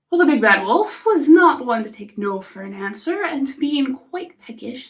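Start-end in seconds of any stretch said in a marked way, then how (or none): none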